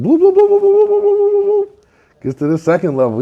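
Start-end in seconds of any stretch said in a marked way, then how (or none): 0.0-1.7 very high burbling gulps, starting loud; long rapid high bloop-bloop gobble; cartoon gobbling noise. Turkey gobbling a second nugget, high cartoon burble.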